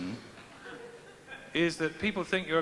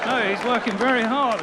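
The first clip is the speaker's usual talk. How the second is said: loud, higher comic retraction; loud jokey correction; loud mock denial; 6 semitones higher, 8 dB louder than usual. Real, not acted. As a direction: insist loudly he really works.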